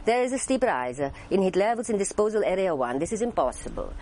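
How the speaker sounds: lilting mock-Italian accent. Shown as a demonstration. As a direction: put on a musical Italian accent. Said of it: "with an Italian accent".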